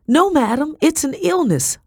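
high, pleading cry; urgent, high-voiced protest; desperate cry. A narrator's reading. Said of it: "I cried".

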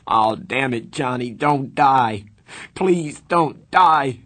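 loud, drawn-out, rising cry of anguish; desperate begging. A narrator's reading. Begging a dead friend not to die, desperate. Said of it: "he begged".

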